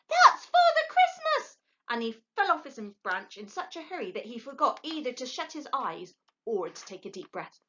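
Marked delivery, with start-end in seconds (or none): 0.1-1.6 very high, slow thrilled squeal; excited shriek. A narrator's reading. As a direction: burst with delight. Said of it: "shrieked Plop".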